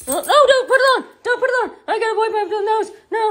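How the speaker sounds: high, loud, fussy puppy; high-pitched talking pet, rushed; protesting dog voice. Pretend. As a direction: squeal in protest like a flustered dog. Voices dog refusing the harness.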